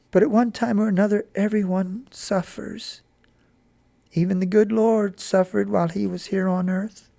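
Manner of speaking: a hint of a tender mother; comforting mother.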